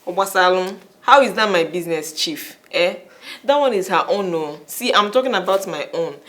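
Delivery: loud demand. Acted. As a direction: make him hear you. Wife loudly demanding.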